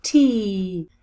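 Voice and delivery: t joined to a long ee, loud and drawn out; long, strong final tee; blended syllable. Shown as a demonstration. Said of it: "Sixth syllable".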